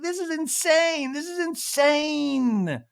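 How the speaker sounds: high, slow, drawn-out disbelief; incrédule, aigu et traînant; exasperated disbelief. Retold or imitated. Taylor overwhelmed in the fight.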